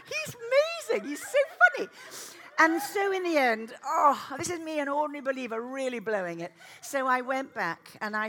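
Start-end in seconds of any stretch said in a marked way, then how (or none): 0.1-4.6 laughing. Real, not acted. Laughing delightedly.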